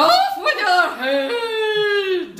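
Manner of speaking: loud, slow, drawn-out villain queen shout; evil queen. Acted. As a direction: bellow it like a wicked queen. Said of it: "in your Evil Queen voice".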